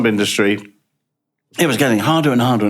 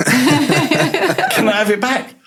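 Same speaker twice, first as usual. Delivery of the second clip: cheeky laughing ask; 14 semitones higher, much slower than usual. Retold or imitated. Cheeky request to new CEO.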